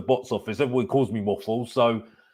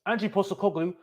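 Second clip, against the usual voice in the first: ordinary talk, nothing marked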